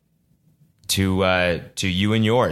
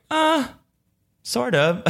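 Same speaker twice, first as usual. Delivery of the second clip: sheepish; much slower than usual. Retold or imitated. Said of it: "I was like".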